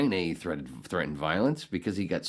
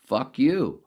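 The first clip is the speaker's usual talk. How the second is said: loud, higher fuck you burst; furious curse; 7 semitones higher, 7 dB louder than usual. Real, not acted. Cursing at the critics who smeared Roseanne, furious. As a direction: hurl it at them.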